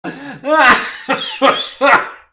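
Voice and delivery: long loud high cackle of laughter. Real, not acted. Streamer still cracking up at the joke, loud high laughter. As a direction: keep laughing hard and high.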